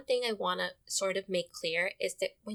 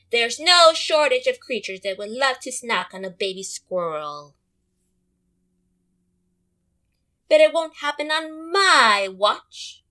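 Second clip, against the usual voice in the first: protective Mama Squirrel; 2 semitones higher, 11 dB louder than usual. Acted. Mama Squirrel warning in story.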